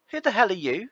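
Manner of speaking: mock neighbour. Neighbour confronts barbecue.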